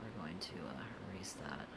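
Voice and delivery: soft unvoiced whisper. Real, not acted. Streamer erasing chat's cursed drawing, whispering. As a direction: mutter it under your breath.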